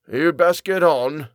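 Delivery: high, slow creaky elder; creaky old voice. A narrator's reading. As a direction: creak it out slowly, high-pitched. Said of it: "a voice creaked behind us".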